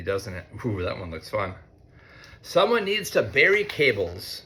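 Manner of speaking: ordinary talk, nothing marked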